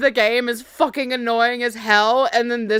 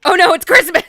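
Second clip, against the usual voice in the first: high, loud delighted outburst; excited outburst; 8 semitones higher, 9 dB louder than usual. Real, not acted. Player reacting to the game's Christmas twist, excited.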